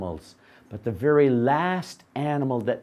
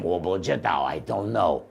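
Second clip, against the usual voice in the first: dopey donkey; 8 semitones lower than usual. Acted. Donkey still unsure.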